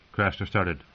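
usual speaking voice

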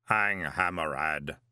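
slow, low solemn calling of a name; solemn golem voice. A narrator's reading. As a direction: say the name slowly and gravely.

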